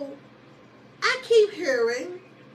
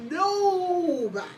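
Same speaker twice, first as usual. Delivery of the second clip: NOBODY stretched slowly, higher at the start; drawn-out emphasis; 4 semitones higher, slower than usual. Real, not acted. Telling listeners who liked her, drawn-out emphatic NOBODY.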